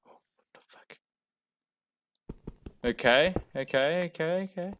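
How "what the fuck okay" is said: ordinary talk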